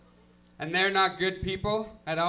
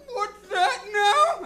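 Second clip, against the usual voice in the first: high, slow, loud straining what; hard of hearing old guy; 8 dB louder, much slower than usual. Retold or imitated. Deaf villager shouting back at a greeting, high and slow. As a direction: cup the ear and bellow the question. Horn guy can't hear.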